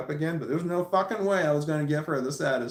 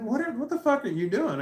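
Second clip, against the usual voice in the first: exasperated, high-pitched protest; indignant retort; 9 semitones higher than usual. Retold or imitated. Arguing as she tugs his arm again, indignant. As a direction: stumble into an incredulous demand.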